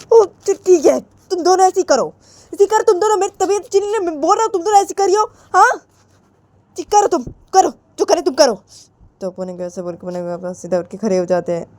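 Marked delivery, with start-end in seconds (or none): 0.1-8.5 high-pitched, exasperated outburst; fed-up father. Acted. Unwell father fed up with both of them, exasperated. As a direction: give up on them in a high, heated rant.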